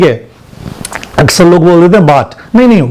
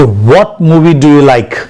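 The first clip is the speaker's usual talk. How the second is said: slow repeat-after-me pattern drill; slow, even teaching rhythm; much slower than usual. Shown as a demonstration. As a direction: drill each question slowly for the class. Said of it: "इसे जिंदगी में लगाइए और use कीजिए".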